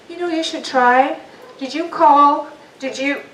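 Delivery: pushy helpers. Retold or imitated. Mimicking pushy helpful people.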